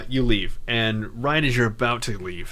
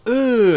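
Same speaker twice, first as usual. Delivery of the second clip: exasperated groan; 12 semitones higher, 8 dB louder than usual. Retold or imitated. Protagonist's frustrated reaction retold.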